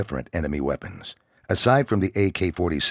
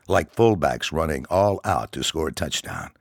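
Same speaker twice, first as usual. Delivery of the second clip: usual speaking voice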